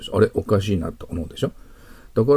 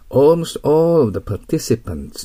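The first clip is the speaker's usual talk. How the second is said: slow, clear reading of Almost all of the participants; slow model reading; 6 semitones higher, 4 dB louder, much slower than usual. Shown as a demonstration. Teacher reads the answer slowly to students. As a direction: read each word out slowly for the class. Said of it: "ね、いきますよ".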